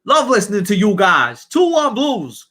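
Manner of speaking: hammed-up American accent. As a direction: read the fan message as a New Yorker. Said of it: "Was that good?".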